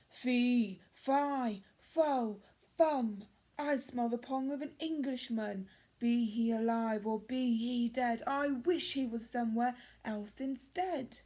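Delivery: loud, booming giant chant; roaring giant. Acted. Giant catching the boy's smell, loud roar. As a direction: boom it out like a hungry giant. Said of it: "roared the giant".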